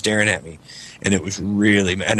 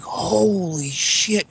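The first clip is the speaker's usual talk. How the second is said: high, long-drawn mock dread; acting out a spooked reaction; retold alarm; 9 semitones higher, much slower than usual. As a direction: stretch it out slowly in stunned alarm. Retold fright at seeing the lady.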